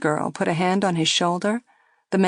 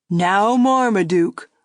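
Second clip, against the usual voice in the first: slow, louder chiding; man mock-chiding; 2 semitones higher, 5 dB louder, slower than usual. A narrator's reading. Man chiding his dummy Marmaduke. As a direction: chide the dummy. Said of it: "the man said to the dummy".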